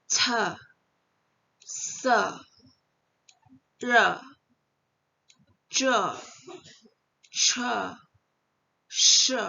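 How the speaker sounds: C, se, re, je, che, she each ending in an open "uh"; one isolated syllable at a time, C to she, teeth apart; light, open vowels. Shown as a demonstration. Teacher drilling open E syllables, pronunciation demo. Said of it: "jadi dia lebih ringan kesannya".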